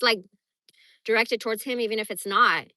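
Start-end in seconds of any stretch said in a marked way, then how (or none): none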